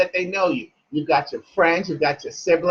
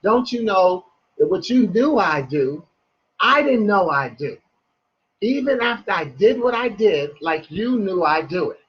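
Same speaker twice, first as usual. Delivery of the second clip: deliberate phrases with clear pauses between; slowed-down poem line, each clause set apart. Shown as a demonstration. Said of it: "I'll say it in a slow way".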